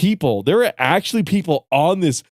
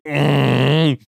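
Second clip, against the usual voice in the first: imitated grunting and groaning; much slower than usual. Mimicking friend losing at the game, grunting and groaning. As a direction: groan into the words like a struggling gamer. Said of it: "hear him grunting and groaning".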